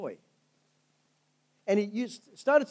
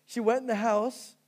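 ordinary delivery

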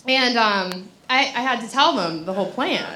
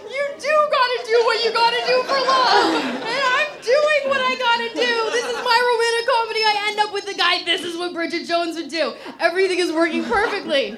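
much higher, breathless romcom elation; giddy excitement; 10 semitones higher than usual. Retold or imitated. Answering the customs agent, giddy and much higher. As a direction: gush like you've won at love.

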